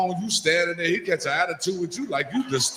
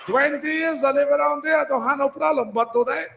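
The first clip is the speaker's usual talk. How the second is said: higher, rising to a shout, mock Spanish accent; store owner rant, Spanish accent; 8 semitones higher than usual. Store owner scolds regular customer over forty, mock Spanish accent.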